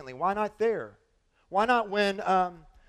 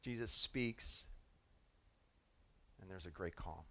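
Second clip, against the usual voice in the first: hushed; 11 semitones lower, 18 dB quieter than usual. Real, not acted. Dramatic hushed opening of sermon.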